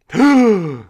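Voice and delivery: sharp high loud gasp; a shocked intake of breath; one loud startled gasp. Acted.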